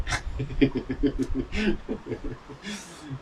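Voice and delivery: high, hearty laugh; a real burst of laughter at the stranger's joke. Real, not acted. Laughing at the funny memory.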